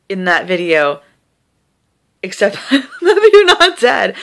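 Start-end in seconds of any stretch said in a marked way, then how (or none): 2.2-4.1 real laugh breaking into the words. Real, not acted. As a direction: say it through a high, relieved laugh. Joking through relief about her video.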